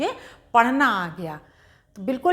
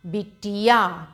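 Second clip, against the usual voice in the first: बिटिया stretched slowly, syllable by syllable; slow teacher blending; slowly blended word; much slower than usual. Shown as a demonstration. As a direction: sound out बिटिया for a learner. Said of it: "तोड़ तोड़ के पढ़ेंगे".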